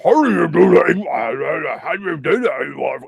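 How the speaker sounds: loud, distorted mock voice; booming put-on creepy voice; Ewar impression. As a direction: mimic a creepy modulated voice, overdone. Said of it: "What kind of voice is that?".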